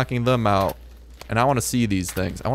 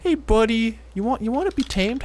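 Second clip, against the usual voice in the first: much higher sing-song to an animal; pet talk; 11 semitones higher than usual. Real, not acted. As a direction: coax the little creature sweetly. Sweet-talking the wild jerboa.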